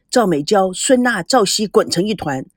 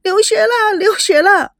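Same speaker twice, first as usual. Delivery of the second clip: high, wailing cry of pain; a wail of pain, much higher; 10 semitones higher, 3 dB louder than usual. A narrator's reading. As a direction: whimper out loud like a hurt girl. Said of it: "大声地哭了起来".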